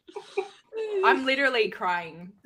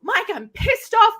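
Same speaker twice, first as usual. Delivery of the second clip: mock angry; 10 semitones higher, 4 dB louder, slower than usual. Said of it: "I'm like".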